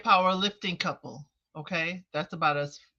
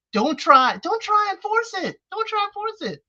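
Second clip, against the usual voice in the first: firm insistence; 14 semitones higher, 5 dB louder than usual. Real, not acted. Lecturing Kojo on boundaries.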